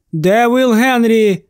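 drawn-out call from below. A narrator's reading. Doctor below calls for his assistant, calling out. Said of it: "крикнув він".

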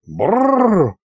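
high trilled shudder; drawn-out, shuddery brrr. A narrator's reading. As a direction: roll the lips in a shiver of disgust. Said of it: "содрогнулся Аристофан".

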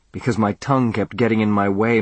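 ordinary delivery, nothing marked

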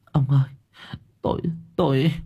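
high, soft, shaky stammer; frightened wife faltering to her husband; trembling voice. A narrator's reading. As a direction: voice trembling as she begins. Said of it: "giọng bà run rẩy".

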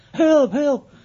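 high, loud shouted help; drowning cry. Retold or imitated. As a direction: call out as if drowning. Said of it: "Verzuipen, aan het verzuipen was".

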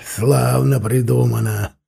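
dry, bitter quip on славно; gloomy sarcastic. Acted. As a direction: sneer at the plan. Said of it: "угрюмо бросил Прат".

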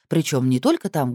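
ordinary delivery, nothing marked

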